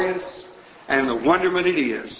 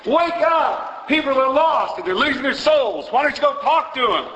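high-pitched, urgent cry of rebuke, re-enacted; retold exhortative shout; 8 semitones higher than usual. Young preacher to his congregation about lost souls, retold high-pitched shout. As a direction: shout it as a young man rousing a sleeping crowd. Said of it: "stand up and shout at the congregation".

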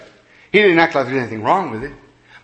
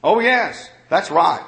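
normal talking voice

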